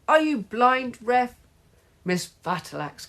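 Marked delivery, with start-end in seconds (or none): 0.1-1.4 high, loud indignant challenge; screamed. A narrator's reading. Mum berating the referee, loud scream. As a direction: yell it at the referee. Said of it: "screamed Margaret's mum".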